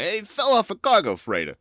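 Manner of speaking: loud, high shady-trader excuse; high, loud alien dealer. Acted. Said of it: "he actually says something like".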